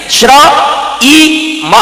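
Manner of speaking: "श्र, ई, म" sound by sound, with the ई held long and high; teacher spelling out "श्र, ई, म" slowly, one sound at a time; syllable breakdown. Shown as a demonstration. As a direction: split the word into "श्र", a long sung "ई", then a short "म". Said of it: "दाई मात्रा मिले तो श्रीम होता है".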